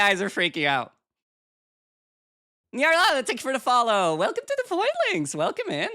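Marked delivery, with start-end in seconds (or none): 2.7-5.9 cute anime streamer. Acted. Greeting follower.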